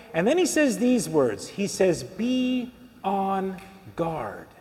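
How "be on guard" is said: very slow and softer, word by word; solemn quoted warning. Retold or imitated. Preacher quotes Jesus warning the crowd, slow and solemn. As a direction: draw out each word as a grave warning.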